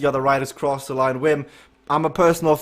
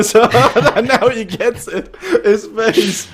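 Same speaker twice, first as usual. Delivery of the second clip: high, loud talking through laughter; real laughter; 8 semitones higher, 5 dB louder, slower than usual. Real, not acted. Host cracks up at Vic's face, laughing. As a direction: crack up while getting the words out.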